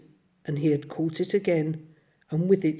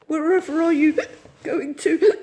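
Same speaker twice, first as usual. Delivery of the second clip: high, loud, crying question; bewildered weepy cry; tearful; 11 semitones higher, 5 dB louder than usual. A narrator's reading. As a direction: ask through tears. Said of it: "replied the tearful Mole".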